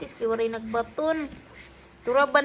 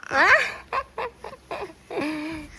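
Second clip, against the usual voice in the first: laughing; 6 semitones higher than usual. Real, not acted. Parent laughs at baby.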